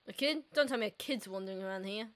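ordinary talk

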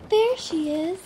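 high, loud excited call; loud happy bird shout. A narrator's reading. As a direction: call out with joy. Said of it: "said the baby bird".

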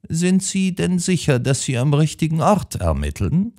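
put-on high, incredulous old woman's voice; an elderly lady doubting the detective; high-pitched, disbelieving question; indignant old lady. A narrator's reading.

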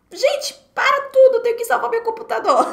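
high, loud alarmed cry to the class; mock panic. Teacher reenacting a spill in class, mock panicked alarm. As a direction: squeal it like a sudden emergency.

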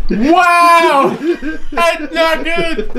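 laughing, high pitched disgust; high, laughing yuck. Real, not acted. Reacting to awful bean flavor.

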